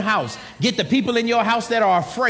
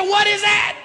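high-pitched, acted-out shock; retold alarmed question; startled disbelief; 11 semitones higher, 3 dB louder than usual. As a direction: blurt it high, as if just shocked. Retells his startled reaction to miracle.

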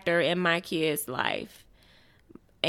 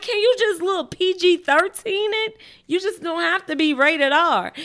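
mock pleading; 9 semitones higher, 3 dB louder than usual. Mom asking son to tone down.

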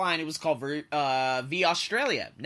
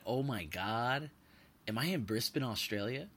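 awed hush; 5 semitones lower, 8 dB quieter than usual. Retold or imitated. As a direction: say it in quiet amazement. Landing, seeing the city lights.

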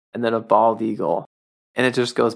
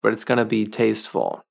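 ordinary delivery

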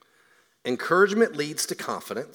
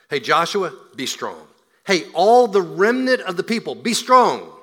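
building call; 5 semitones higher, 10 dB louder than usual. Acted. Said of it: "he says, be strong".

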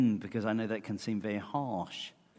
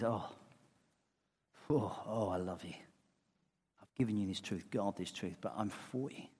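soft, lower, tender reassurance; 3 semitones lower, 5 dB quieter than usual. Retold or imitated. Paul reassuring Timothy, soft and tender. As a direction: hush it like a loving father. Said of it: "what he wants to say is".

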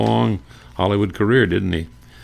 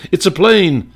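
much higher, louder woman's cry; excited woman bystander, pitched up; female bystander voice; 11 semitones higher, 6 dB louder than usual. Retold or imitated. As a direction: voice a woman spotting a plane. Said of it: "a female voice said".

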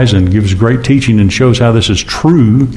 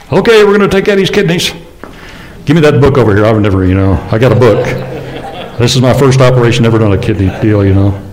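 mock clueless surgeon; faster than usual. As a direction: play a cocky surgeon who just read the book.

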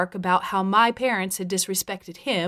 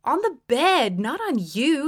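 higher-pitched, mock-reasonable correction; matter-of-fact correction, pitched up; reasonable-sounding; 6 semitones higher than usual. A narrator's reading. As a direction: explain it as if perfectly reasonable. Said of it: "as if it was perfectly reasonable".

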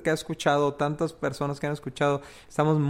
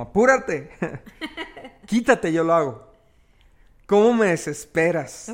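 impatient, sharp and a bit louder; exasperated grandma scolding; 4 dB louder than usual. Acted. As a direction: snap at your spouse to hurry up. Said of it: "Cuando en un matrimonio hay una actitud impaciente".

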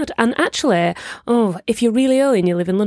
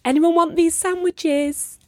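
high, drawn-out sing-out; plaintive mock street-seller call; mock hawker's call; 10 semitones higher, much slower than usual. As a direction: call out for takers, long and high. Imagined hawking sandwiches to nobody.

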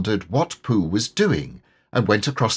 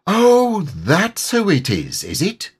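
Pooh voice; 10 semitones higher, 4 dB louder, slower than usual. A narrator's reading. Pooh mild.

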